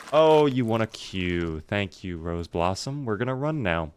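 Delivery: usual speaking voice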